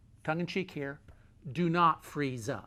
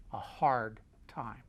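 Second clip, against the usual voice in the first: usual speaking voice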